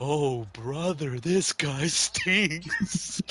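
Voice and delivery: slow, loud, mocking crowd heckle; heckler call. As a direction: drag it out like a bored fan shouting from the seats.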